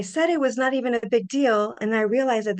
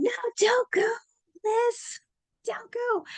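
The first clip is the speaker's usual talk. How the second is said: much higher, begging the feeling to stay; pleading; slower than usual. Retold or imitated. Begging her own bliss not to leave, pleading.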